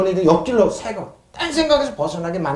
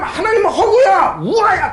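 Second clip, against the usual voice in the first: higher, sneering scoff at "하나님은 허구야"; mocking skeptic; 10 semitones higher, 3 dB louder than usual. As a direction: sneer it like an unbeliever dismissing God. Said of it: "허구 우화로". (하나님을 비웃는 높은 조롱조 목소리)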